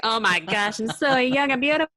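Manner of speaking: loud, high self-admiring squeal of delight; exaggerated swooning at your own reflection; mock gushing. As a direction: gush over yourself, hammed up and loud. Acts out gushing at own showreel.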